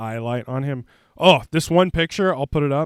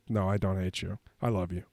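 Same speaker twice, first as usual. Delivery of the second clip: much quieter, lower aside; soft reassurance; 6 semitones lower, 10 dB quieter, faster than usual. Real, not acted. Reassuring viewers after a joke insult, soft and low. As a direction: take it back softly, almost under the breath.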